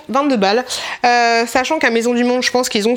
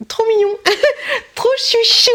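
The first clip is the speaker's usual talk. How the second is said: giggly gushing; 11 semitones higher than usual. Real, not acted. Gushing over pink car freshener.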